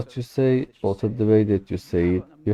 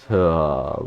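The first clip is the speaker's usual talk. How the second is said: long breathy sigh on the out-breath; audible sigh out; 5 semitones lower than usual. Shown as a demonstration. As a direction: let the breath go with a big sigh. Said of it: "and sigh out".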